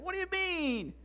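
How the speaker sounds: high, scandalised question; indignant Pharisee. Acted. Pharisee reacting to forgiveness of sins, indignant. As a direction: object in disbelief, pitch leaping up. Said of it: "they got to muttering around with one another".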